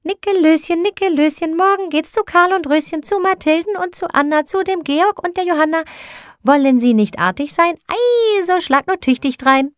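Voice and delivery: put-on very high, childlike chanting voice; shrieking little angels. A narrator's reading. Little angels whirl round Nikolaus teasing him, high shrieking chant. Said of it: "und schrien".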